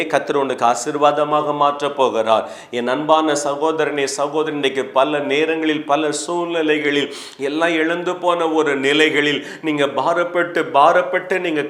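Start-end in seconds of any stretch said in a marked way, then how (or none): none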